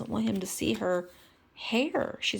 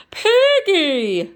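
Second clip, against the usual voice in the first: high, loud alarmed cry; 12 semitones higher, 10 dB louder than usual. Acted. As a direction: call out in shock. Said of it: "cried Kermit".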